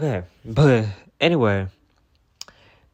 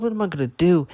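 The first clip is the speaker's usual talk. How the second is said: panicked cry; 8 semitones higher, 5 dB louder, faster than usual. Retold or imitated. Fleeing the hawk.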